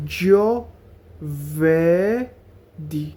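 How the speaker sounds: very slow broken syllables; জোভেরদি split into slow syllables. Shown as a demonstration. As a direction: say জোভেরদি as jo-ver-di. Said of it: "এভাবে syllable ভাঙা ভাঙা".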